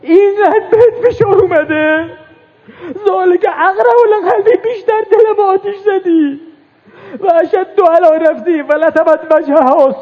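high, sobbing wail; crying out. Acted. Preacher voices Zainab's loud crying lament. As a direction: wail it through tears.

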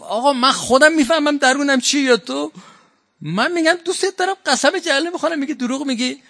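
the insulted man who swore and was doubted; indignant protest. Retold or imitated. Kharijite protesting to Ali after being called a liar, high indignant voice.

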